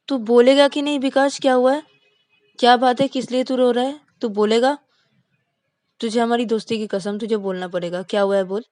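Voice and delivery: pleading friend. Acted. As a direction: beg him to open up, swearing on friendship.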